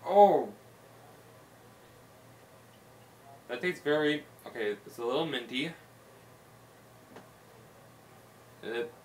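talking with food in mouth; chewing while talking. Real, not acted. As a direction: mouth partly full of snack. Seen 0: Holds small snack at lips, mouth working between bites throughout.

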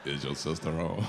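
very low smooth phone reply; deep telephone voice. Shown as a demonstration. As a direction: go deep to impress the caller. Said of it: "you always get deep".

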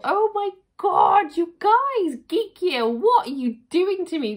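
high, loud, overwhelmed exclamation to the viewers; excited shout. Real, not acted. Telling Geek Gear what they're doing to her, loud high excited cry. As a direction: cry out to the brand in delighted disbelief.

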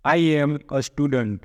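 very slow, each word spaced out; careful classroom dictation; slow model reading. Shown as a demonstration. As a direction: read I am a student. word by word for learners. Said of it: "હવે જુઓ".

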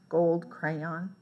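usual speaking voice

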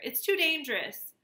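high-pitched, urgent warning; alarmed warning. A narrator's reading. Flock stopping their leader, alarmed warning. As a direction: warn him off with alarm.

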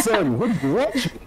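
high, laughing parent voice; shocked parent. Retold or imitated. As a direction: play the scandalized parent. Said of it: "their parents will sit in the room".

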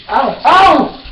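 sharp cries of pain, rising; pained yelps. Real, not acted. Yelping in pain after touching the hot pan. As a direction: yelp as the pan burns you. Burned while cooking, crying out.

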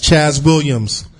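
usual speaking voice

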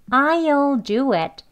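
slow, emphatic "I'll do it"; emphatic full form. Shown as a demonstration. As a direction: stress "I'll" slowly without reducing it. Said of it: "이렇게 강조할 때는요".

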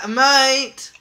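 loud, high, stretched Australian "mate"; Australian accent. Acted. Player catching on to the slang, hammed-up Australian accent. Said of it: "with an Australian accent".

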